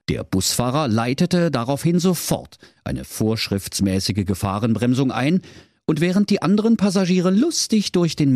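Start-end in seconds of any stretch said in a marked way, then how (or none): none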